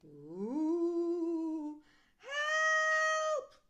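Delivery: very high, loud mock panic; hammed-up shriek for help; scared cry for help. Frightened skeleton cries out, very high and loud.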